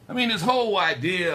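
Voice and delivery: ordinary talk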